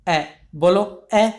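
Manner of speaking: ए drawn out slowly and clearly; teacher modelling a vowel to repeat; vowel demo. Shown as a demonstration. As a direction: hold ए long for students to copy. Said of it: "तो बोल के देखो".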